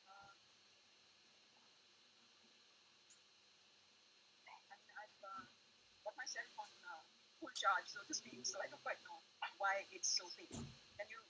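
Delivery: faint, far-off voice on a weak connection; very soft and distant, hard to make out; barely audible. Real, not acted. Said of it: "Your, your voice is very faint".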